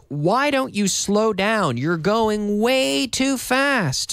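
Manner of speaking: slow, careful teacher read; slow clear demo. Shown as a demonstration. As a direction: spell it out slowly for learners. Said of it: "the intermediate sentence".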